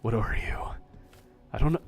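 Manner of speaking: ordinary delivery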